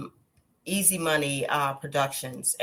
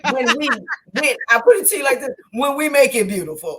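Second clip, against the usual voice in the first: emphatic; 7 semitones higher, 8 dB louder, faster than usual. Real, not acted.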